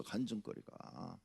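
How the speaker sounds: a quiet afterthought echo of 간증거리가; fading echo. Shown as a demonstration. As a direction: let 간증거리가 drop away softly and slowly. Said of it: "간증거리가 없어".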